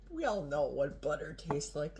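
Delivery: normal talking voice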